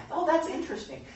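high, airy fake interest; mock mild interest. Teacher imitates himself reading comfortably, mock mild interest. Mocks a cosy Bible reader.

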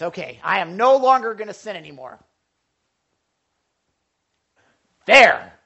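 hammed-up self-satisfied vow; mock resolve. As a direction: announce it like a done deal. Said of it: "here's what it is".

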